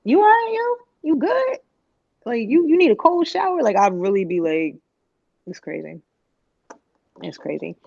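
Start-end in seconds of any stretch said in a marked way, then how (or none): 0.0-3.6 high, teasing "you good?"; mock concern. Scolding her past self, high mock-concerned. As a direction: pitch it up high like checking on a friend.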